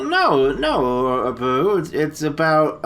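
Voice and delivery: ordinary talk, nothing marked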